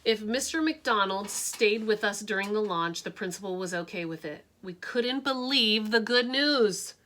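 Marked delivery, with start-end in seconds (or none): none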